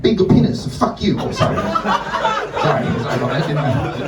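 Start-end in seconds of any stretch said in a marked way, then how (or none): 0.0-1.2 blunt retort. Retold or imitated. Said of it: "Someone's like".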